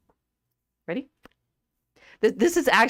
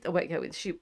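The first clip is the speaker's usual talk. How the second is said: ordinary delivery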